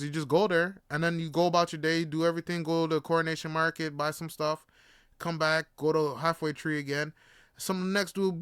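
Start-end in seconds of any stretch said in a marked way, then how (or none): none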